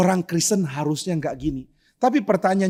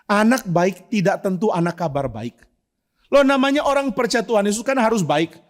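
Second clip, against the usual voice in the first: normal talking voice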